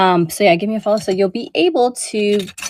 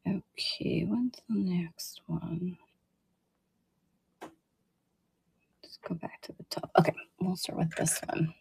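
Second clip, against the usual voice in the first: whispered to herself; 15 dB quieter than usual. Real, not acted. Seller on a live show, whispering to herself while finding the next item. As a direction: whisper as you search for the next piece.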